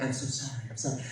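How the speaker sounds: hushed, low apology; retold apologetic mother. Mom apologizing behind her son, hushed and low. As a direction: apologize under your breath as mom. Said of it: "my mom's behind me like".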